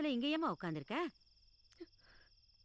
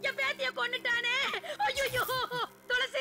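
anguished wailing; 12 semitones higher than usual. Acted. Grandmother finding her granddaughter dead, wailing in anguish. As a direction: cry out in shock and grief over her loss.

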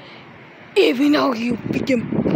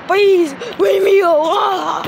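crying shout; 5 semitones higher, 5 dB louder than usual. Acted. Crying, shouting to be left alone.

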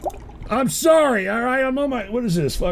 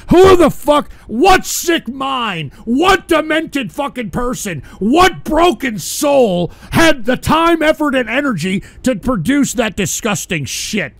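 outraged rant; 5 semitones higher than usual. Real, not acted. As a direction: rage at whoever made it. Ranting about the clip's creator.

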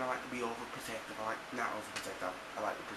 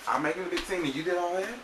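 loud, higher-pitched incredulous comeback; indignant disbelief; 7 semitones higher, 6 dB louder than usual. Retold or imitated. Retorting to the friend who made a scene, indignant disbelief. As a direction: throw the accusation back, louder and higher.

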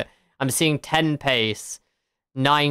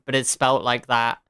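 usual speaking voice

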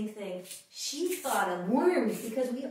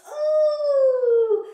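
loud high ooh; excited ooh; 13 semitones higher, 12 dB louder than usual. Acted. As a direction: squeal with delight. Excited exclamation.

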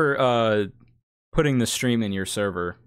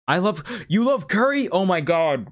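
excited; 7 semitones higher than usual. Real, not acted. Excited to learn friend loves curry.

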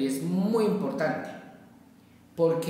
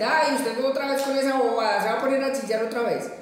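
higher, mocking sister scolding, slightly quick; scornful sister; 6 semitones higher, 3 dB louder than usual. The judging sister mocks her crying sibling, in a scornful higher voice. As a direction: sneer it like a dismissive sister. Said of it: "que le va a decir".

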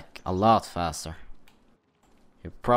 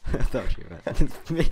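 laughing; 10 semitones higher, 4 dB louder than usual. Real, not acted. Laughing while thinking it meant her.

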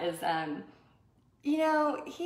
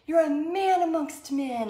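higher, louder, slow mock cheer; cheering friend; 8 semitones higher, 5 dB louder than usual. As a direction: hype up your buddy's shot. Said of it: "he's yelling and cheering".

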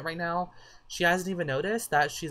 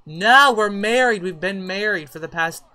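indignant outburst; 4 semitones higher, 10 dB louder than usual. Real, not acted. Player objecting to a Sim breaking up a marriage, indignant. Indignant retort at game characters.

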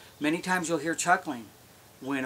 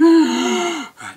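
loud high grunt on a sharp in-breath; raspy inhaling bull elk grunt; inhaled bull grunt; 14 semitones higher, 13 dB louder than usual. Shown as a demonstration. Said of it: "So I'm inhaling".

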